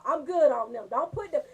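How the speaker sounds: ordinary talk, nothing marked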